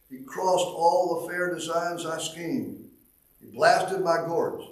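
solemn recited hymn verse, measured and lifted; hymn recitation. Acted. Preacher reciting a hymn to the congregation, solemn and lifted. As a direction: read the old hymn like a poem from the pulpit.

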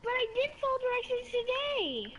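whiny protest. Real, not acted. Protests parents' no.

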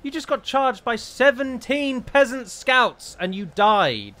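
high, loud, slowed exasperation; fuming at the screen; outraged gamer rant; exasperated disbelief. Real, not acted. General killed by peasants.

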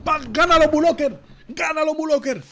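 high, loud rooting shout; excited fan yelling for a win; urging cheer. Real, not acted. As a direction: cheer the player on at full voice. Cheering on a player in the hand.

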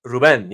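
"Ruben" with the stress on the second syllable; modelling the accented end of "Ruben"; confirming Spanish pronunciation. Shown as a demonstration. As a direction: confirm Ruben as roo-BEN. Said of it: "How do you say your first name?".

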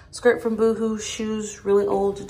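usual speaking voice

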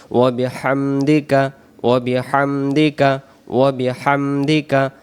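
slowed careful chant; unhurried prayer recitation. Shown as a demonstration. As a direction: recite each word deliberately. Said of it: "নামাজের সানা।".

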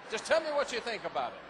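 amused knowing repeat; laughing repeat. Shown as a demonstration. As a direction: replay the line laughing at his own cunning. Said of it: "That's all I said".

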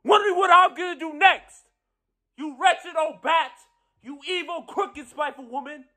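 high, loud tirade of insults; shouted triumph, easing in volume but staying high-pitched; furious gloating. Acted. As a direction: explode at your enemy, voice leaping way up. Gloating insults hurled at enemy.

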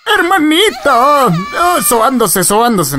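high, hammed-up wail, falling; mock wailing. Player mimicking the sobbing game character, mock wailing. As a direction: wail it high in pretend sobs, easing down.

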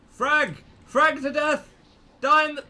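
high, loud attack yells; battle shouting. Real, not acted. Player attacking an enemy, frantic battle shouts. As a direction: yell as you go for the kill.

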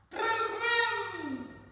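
high, loud playful vrooming; car engine imitation. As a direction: rev it like a child playing cars. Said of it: "he said when he saw the cars".

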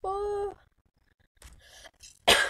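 usual speaking voice

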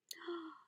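sharp intake of breath in delight; delighted gasp. Real, not acted. Gasps at the dangle lace from Gail, delighted gasp.

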